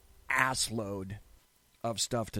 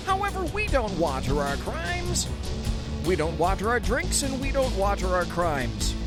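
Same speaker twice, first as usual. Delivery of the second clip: showman catchphrase; 7 semitones higher, 5 dB louder than usual. Acted. Barroom catchphrase.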